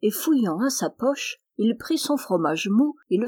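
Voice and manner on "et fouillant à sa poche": ordinary delivery, nothing marked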